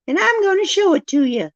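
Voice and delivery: camp it up, showing off the deck; flirty high voice. Pretend. Showing off her new deck to chat, mock flirty high voice.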